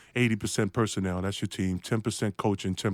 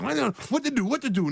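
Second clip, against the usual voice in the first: higher-pitched, flustered self-questioning; mock panic; 9 semitones higher than usual. Rookie caught out guarding Larry Bird, mock panic. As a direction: act out a rookie panicking on defense. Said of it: "Now I'm panicking".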